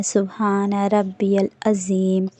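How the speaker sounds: سبحان ربی العظیم very slow, ربی joined to العظیم; slow clear tasbih. Shown as a demonstration. Teacher models correct recitation, slow. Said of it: "تو آپ کو پڑھنا ہے".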